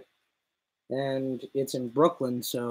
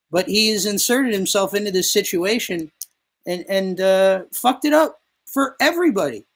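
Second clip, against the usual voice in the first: heated rant; 5 semitones higher, 6 dB louder than usual. Real, not acted. Ranting about demeaning treatment.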